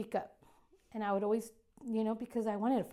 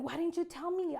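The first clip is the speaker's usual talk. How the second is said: higher, pressing mock-angry question; retold mad question; 7 semitones higher than usual. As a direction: replay the frustration at mom, pitched up. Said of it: "I was so mad".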